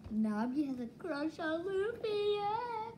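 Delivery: high, drawn-out gushing; gushing squeal. Real, not acted. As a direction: voice climbing into a high whine. Gushing over a suspected crush.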